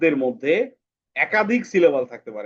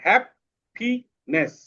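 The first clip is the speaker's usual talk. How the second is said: "Happiness" slow and higher, in three beats; split into syllables; 7 semitones higher, much slower than usual. Shown as a demonstration. Teacher proving three syllables to pupils, "happiness" split slowly. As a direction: pause after each syllable of "happiness". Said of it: "তিনটা syllable আছে".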